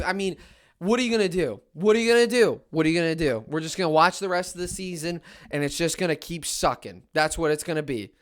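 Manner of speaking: ordinary delivery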